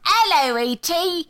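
high, loud mock British greeting; British accent. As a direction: ham up a cheery British fan.

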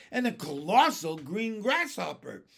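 loud, amazed announcement; astonished. Acted. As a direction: call out each impossible sight. Captain keeps listing giant creatures.

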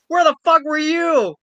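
high, loud disbelieving shout; mock outraged yell. Friend voicing her outrage at the faculty, shouting. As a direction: yell it at the absent teacher.

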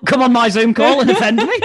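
very high, loud indignation, half laughing; mock outrage. Host scolding guest who called him shrill, mock outrage. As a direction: squeal it in playful offence.

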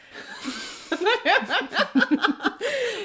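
long, loud, high laugh; hearty laughter. Real, not acted. Amused by her own self-talk.

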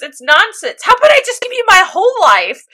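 defiant Winry. Retold or imitated. Winry countering Ed's proposal, high and bold. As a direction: shoot it back boldly. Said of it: "she goes".

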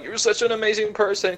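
mock tearful. Storyteller mimics Ando's crying face, mock tearful. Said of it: "this bitch-ass crying face".

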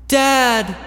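put-on high, desperate child's cry; hammy dramatic reading. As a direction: scream for your father across the snow. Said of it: "a dramatic reading for you".

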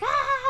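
very high mock scream; mimicked gremlin shriek. Gremlin cut loose and swinging away, mimicked shriek. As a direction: squeal like a falling gremlin. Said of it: "and he's like".

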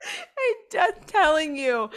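slow, high, laughing through the words; giggly high protest. Real, not acted. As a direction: protest while cracking up. Laughing protest at friend's teasing.

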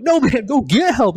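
high, loud, slow shouted order; re-enacted shout over someone's screaming; exasperated yell. Injured jumper to the screaming cadet, exasperated yell.

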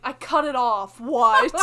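laughing call back to mom; loud retort. Retold or imitated. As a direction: yell it out with a laugh.

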